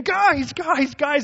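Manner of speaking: urgent loud call from the back of the room; yelling at the top of his lungs. Retold or imitated. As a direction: shout across the church to get their attention. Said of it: "yelling at the top of his lungs".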